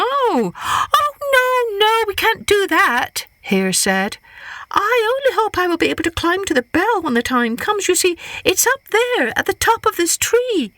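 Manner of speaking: high, quick protesting hare; high flustered Hare voice. Acted. Hare refusing.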